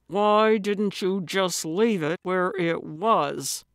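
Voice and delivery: slow, tightly restrained anger; exasperated deputy holding his temper; through clenched teeth. Acted. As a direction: force it out slowly through gritted teeth. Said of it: "Hale asked through clenched teeth".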